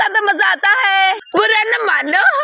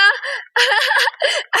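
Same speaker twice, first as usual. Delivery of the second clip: high laugh; 6 semitones higher than usual. Acted. Laughs at soaked Sita.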